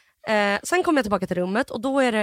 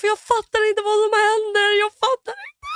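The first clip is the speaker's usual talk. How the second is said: very high, wailing panic; hammed-up despair climbing higher; panicked; 11 semitones higher, 3 dB louder than usual. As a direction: act out a pitched-up panicked wail. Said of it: "jag har panik blandat med skuld".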